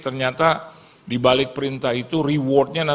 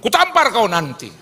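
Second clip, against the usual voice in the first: much higher, louder angry snap; startled threat; 10 semitones higher, 5 dB louder than usual. Retold or imitated. Splashed man threatens the car, loud high shout. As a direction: threaten the driver. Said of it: "Karena kaget bilang".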